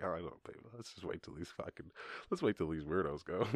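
quiet, conspiratorial murmur; hushed aside. Retold or imitated. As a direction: keep it between you and her. Whispering to his lady.

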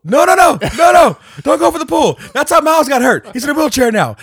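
high, loud mock-panicked shouting; frantic warning. Friend stopping another going for the pool, frantic shouting. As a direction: shout a hammed-up warning at full pitch.

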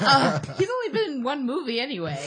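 flustered laugh through words; high, giggling protest. Real, not acted. Flustered after teasing about [name].

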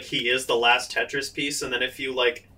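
normal talking voice

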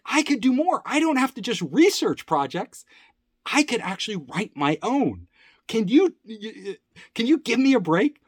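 high, insistent retold plea; eager pleading. Employee begging the boss for a break, eager and high.

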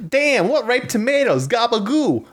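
high Italian-American voice; Brooklyn accent. Acted. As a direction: ham up an Italian-American cook. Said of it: "just making sounds".

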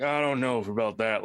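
warm up a doubting character; lightly voiced skeptic; doubtful character voice. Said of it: "this person's gonna be like".

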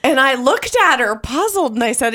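ordinary talk

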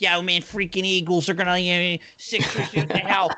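mock an angry caller from Philly sports radio. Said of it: "listening to Philly fans being like".

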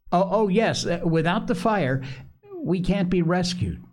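high, excited schoolboy pleading; a fretful boy pressing his point; eager anxious boy. Acted. Piggy urging the boys to keep the fire lit, high anxious voice.

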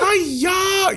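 high, drawn-out mock Chinese cry; mock Chinese accent. Host teases about making fun of accents, mock Chinese accent. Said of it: "made fun of Chinese accents".

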